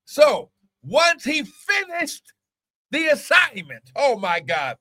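high, drawn-out preacher's cry; full-force preaching. Real, not acted. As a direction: preach it high, leaning on key words.

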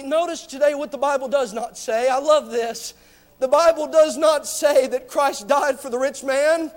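usual speaking voice

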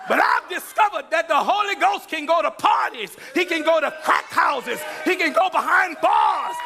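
fiery sermon shout; preaching. Real, not acted. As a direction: preach it out full force. Preaching at full force.

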